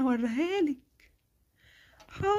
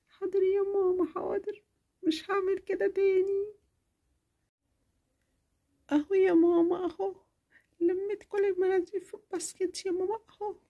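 put-on meek little girl; 3 semitones higher, 4 dB quieter than usual. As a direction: say okay like a told-off kid. Child obeys after scolding.

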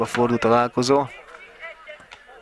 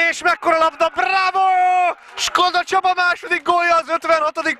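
goal celebration; 8 semitones higher than usual. Real, not acted. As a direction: shout the goal with joy.

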